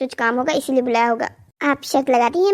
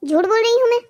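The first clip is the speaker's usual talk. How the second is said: high, offended question rising at the end; affronted mother-in-law, pitch jumping up; indignant challenge; 7 semitones higher than usual. Acted. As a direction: take offence and throw it back. Mother-in-law takes offence at daughter-in-law.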